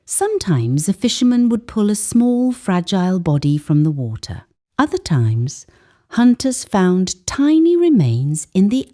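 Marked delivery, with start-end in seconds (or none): none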